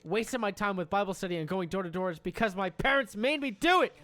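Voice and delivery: hammed-up fed-up ex, rising to a squeal; a mock outraged tirade; imitating angry girlfriend. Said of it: "She gets mad and says".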